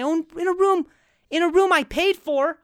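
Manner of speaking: high, loud mock outrage; over-the-top indignation. Guest feels sneaky in paid room, hammed-up indignation. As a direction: pitch it way up and protest loudly. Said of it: "is this too much?".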